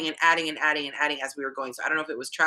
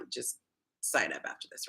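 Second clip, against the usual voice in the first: whispered aside; 5 semitones lower, 10 dB quieter than usual. Real, not acted. Playful aside to viewers.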